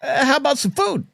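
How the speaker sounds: high, sweet-talking plea for food; wheedling drunk buddies. Retold or imitated. Tipsy friends coaxing Uncle Mark into a food stop, high wheedling voice. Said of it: "Jack and I were like".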